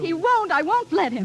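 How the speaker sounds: high, insistent protest; alarmed refusal. Acted. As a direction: refuse it in a fluster. Pupil refuses the stage kiss.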